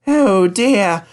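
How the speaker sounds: very high, slow worry; worried. A narrator's reading. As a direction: fret anxiously to yourself. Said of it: "he asked himself sadly".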